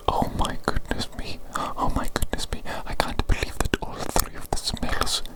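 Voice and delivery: ordinary talk, nothing marked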